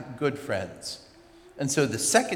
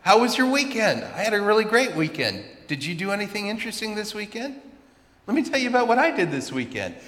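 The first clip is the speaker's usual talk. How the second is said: relentlessly cheery chat; 8 semitones higher than usual. Retold or imitated. One-sided chat with silent classmate.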